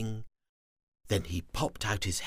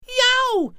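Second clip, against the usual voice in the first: sudden high yelp; 11 dB louder than usual. Acted. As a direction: yelp out in pain. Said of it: "he yelped".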